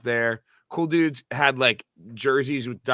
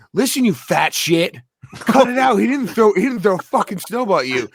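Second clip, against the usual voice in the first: stern loud rebuke; 8 semitones higher, 8 dB louder than usual. Retold or imitated. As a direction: tell him off like the man who finally snaps. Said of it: "he goes".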